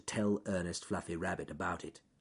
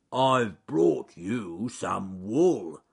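ponderous, drawn-out, loud words; slow bear character; 6 dB louder, much slower than usual. Acted. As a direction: say it very slowly as a lumbering bear.

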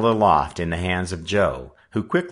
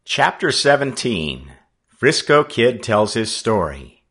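plain reading voice

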